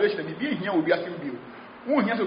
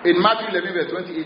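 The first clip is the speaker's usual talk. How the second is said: ordinary delivery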